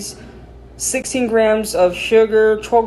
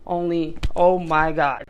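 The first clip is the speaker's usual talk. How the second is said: ordinary delivery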